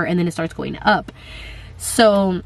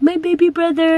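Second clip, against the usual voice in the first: higher, put-on little boy voice; imitating her son; 9 semitones higher than usual. Mom imitating her son Max rubbing her belly, childlike. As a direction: play the excited kid claiming a brother. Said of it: "So Max is always like".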